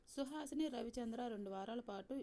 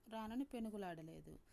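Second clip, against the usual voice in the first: plain reading voice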